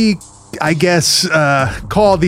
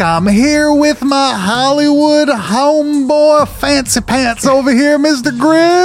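high, hammed-up Southern introduction; Southern accent; 9 semitones higher, slower than usual. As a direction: introduce your buddy in a put-on Southern twang. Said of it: "able to keep that accent the whole way through".

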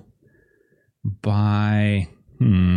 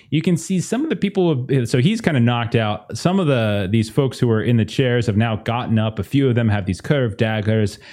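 ordinary delivery

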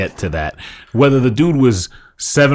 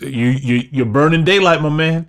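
mock gunman; 6 semitones higher, 3 dB louder than usual. Plays the gun-holding Travolta figure.

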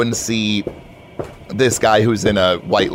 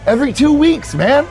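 high, enthusiastic answer; hyped-up bro; 3 dB louder than usual. Acted. Manager answers new hire on pay, hyped-up bro. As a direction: sell payday like a triumph.

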